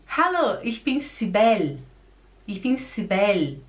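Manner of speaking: "Cibele" ending on the L; near-silent final E. Shown as a demonstration. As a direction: say "Cibele" as si-BEL in a German greeting. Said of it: "o E quase que ele não se, não se escuta".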